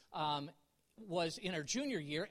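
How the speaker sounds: ordinary delivery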